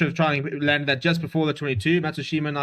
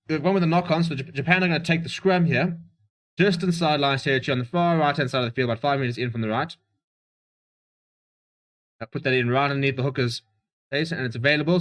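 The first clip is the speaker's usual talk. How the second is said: normal talking voice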